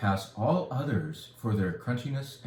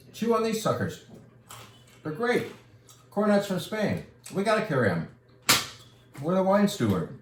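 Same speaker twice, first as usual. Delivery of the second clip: excited pitch; 9 semitones higher than usual. Real, not acted.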